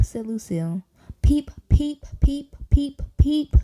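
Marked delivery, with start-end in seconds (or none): 1.2-3.6 high giddy peeps; out-of-control peeping. A narrator's reading. Tired girl peeping out of control, high. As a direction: peep uncontrollably. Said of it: "peeping louder and louder".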